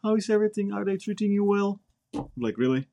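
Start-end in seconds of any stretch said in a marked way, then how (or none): 0.0-1.8 mocking a family member crying over the phone; mock teary call. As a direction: imitate a tearful relative on the phone. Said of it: "And they cry over there on the phone".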